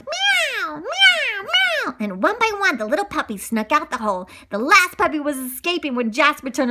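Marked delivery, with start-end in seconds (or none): 0.0-2.0 high drawn-out meows; cat meowing. Acted. Cat meowing to the puppies, high and slow. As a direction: meow like a small cat. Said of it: "The cat meowed".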